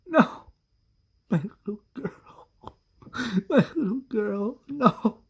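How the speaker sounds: high, broken weeping; crying. Acted. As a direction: keep sobbing over the lost girl. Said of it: "Carol starts crying and says".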